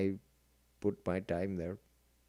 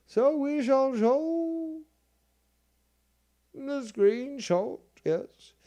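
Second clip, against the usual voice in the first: much higher, very slow and drawn out; mock grand announcer; 4 dB louder, much slower than usual. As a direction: announce it like a pompous herald. Grandly announcing the next item.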